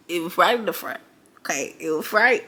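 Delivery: ordinary delivery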